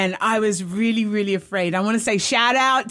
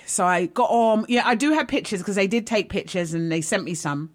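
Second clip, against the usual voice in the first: normal talking voice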